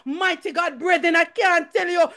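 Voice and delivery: high-pitched, urgent preaching; impassioned church testimony; fervent testimony. Real, not acted. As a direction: testify with rising fervour to the congregation.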